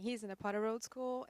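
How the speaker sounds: ordinary talk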